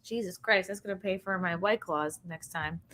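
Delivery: ordinary talk, nothing marked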